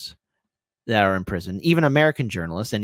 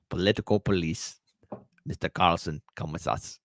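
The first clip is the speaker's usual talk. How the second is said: put-on low, quiet Russian-accented police officer; imperfect Russian accent; 8 semitones lower, 7 dB quieter than usual. Secret policeman at Tucker's door, low Russian-accented summons. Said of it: "that's not a perfect Russian accent".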